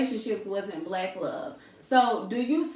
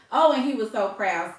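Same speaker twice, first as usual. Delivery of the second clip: usual speaking voice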